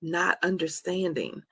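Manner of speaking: normal talking voice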